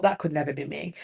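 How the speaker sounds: low, very soft self-talk; dropped-voice inward remark; thinking to herself. Retold or imitated. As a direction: mutter it as a private thought. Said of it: "I always just thought".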